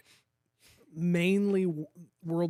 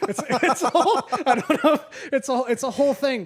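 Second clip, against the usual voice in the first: laughing; 11 semitones higher, 10 dB louder than usual. Real, not acted. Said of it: "laughing embarrassed aside".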